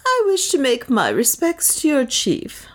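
courteous adventurer addressing the crowd; Leonard. A narrator's reading.

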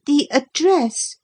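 put-on slow, high-pitched boy's voice; careful boy. A narrator's reading. Boy giving his home address, careful. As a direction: announce it carefully as a small boy.

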